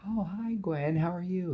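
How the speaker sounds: high-pitched acted hello; friendly greeting. Aunt May greets Gwen at door.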